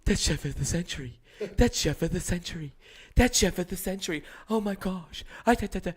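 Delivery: high, building mock-adoring gush; mock the girlfriend raving, rising each time. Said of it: "all I kept hearing".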